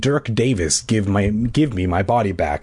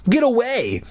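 angry shout; 11 semitones higher than usual. A narrator's reading. Bully waving off a buzzing bee, angry shout. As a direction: shout it up high. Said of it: "he shouted angrily".

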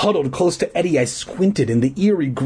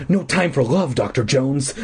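ordinary delivery, nothing marked

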